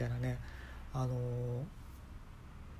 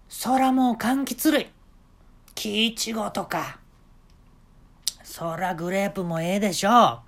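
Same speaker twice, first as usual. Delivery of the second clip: high, loud eager boss voice; a showman giving orders; eccentric shop owner; 6 semitones higher, 4 dB louder, slower than usual. Retold or imitated. (店の大将が客に猿になる手順を指示する、高く大きな声)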